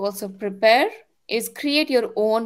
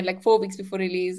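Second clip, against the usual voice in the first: normal talking voice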